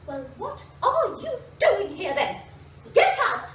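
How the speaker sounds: builds to a loud, high shout on get out; rising into a loud hen's yell; angry hen. Acted. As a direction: chase him out, furious. Hen throws the duckling out.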